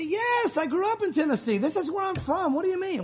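high-pitched, insistent retort; indignant protest. Retold or imitated. Pastor answers people doubting he is from here, indignant protest.

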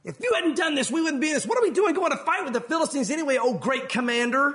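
a bitter soldier turning on his commander; angry accusation. Retold or imitated. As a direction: accuse him, heated and loud. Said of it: "one of them going".

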